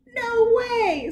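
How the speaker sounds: high, loud disbelief; amazed. Acted. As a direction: exclaim in delighted disbelief. Mark amazed.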